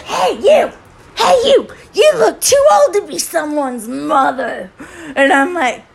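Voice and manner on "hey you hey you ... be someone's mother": high, hammed-up heckle of an old lady; mock yelling. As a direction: imitate an old woman yelling at a stranger. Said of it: "she's yelling at me".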